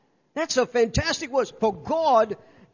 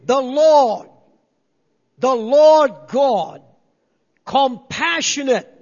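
booming, drawn out, like God announcing himself; loud divine proclamation; 7 dB louder, slower than usual. Acted. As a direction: proclaim each title with weight.

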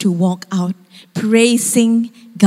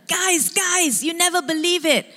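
high, quick excited call to friends; 8 semitones higher than usual. Retold or imitated. Abu runs up to his friends with a ladder, excited. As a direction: burst in with big news, pitched up.

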